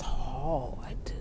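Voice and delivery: slow whisper; slow whisper to herself. Real, not acted. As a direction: breathe it out slowly, no voice. Laughing admission of struggle.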